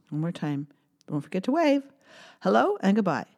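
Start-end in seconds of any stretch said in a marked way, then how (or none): none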